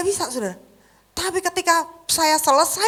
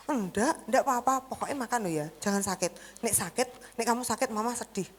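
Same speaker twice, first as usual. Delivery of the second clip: much softer, gentle reassurance; tender mother; 9 dB quieter than usual. Retold or imitated. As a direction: comfort the son quietly. (ibu menenangkan dengan lembut)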